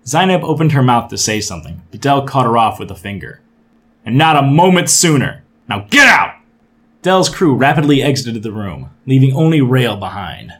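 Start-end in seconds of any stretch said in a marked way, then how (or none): 4.0-6.3 loud, rising angry command; angry shout. Acted. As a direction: throw them out angrily. Captain throws crew out.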